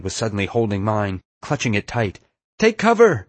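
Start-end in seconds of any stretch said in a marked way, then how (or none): 2.6-3.3 high, loud warning yell. A narrator's reading. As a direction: yell an urgent alarm. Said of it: "she yelled".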